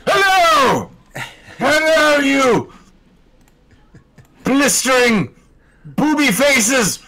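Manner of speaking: high, shouty robot villain bellow; Shock Dave voice. Acted. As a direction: boom it out like a hammy alien robot. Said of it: "Well, Shock Dave".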